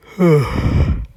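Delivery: loud, drawn-out yawn; breathy yawn escaping mid-story. Real, not acted. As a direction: let out a big tired yawn. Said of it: "me yawning".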